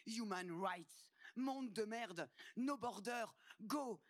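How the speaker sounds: desperate shouted slogans at the border; shouting slogans. Acted. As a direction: yell them like a crowd chant. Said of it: "en hurlant".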